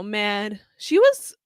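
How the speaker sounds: normal talking voice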